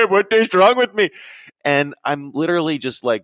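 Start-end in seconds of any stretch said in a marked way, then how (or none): 0.1-1.1 lightly put-on Indian lilt, high and loud; mild imitation of an Indian voice; hint of Indian accent. Describing a vision, slipping into a light Indian accent. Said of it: "I started to talk like this, a little bit feeling like Indian".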